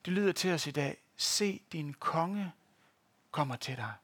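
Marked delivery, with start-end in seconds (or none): 1.2-3.9 slow solemn quote. Acted. Preacher reads the verse to the congregation, slow and solemn. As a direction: slowly draw out "Se, din konge", then let "kommer til dig" drop lower and softer.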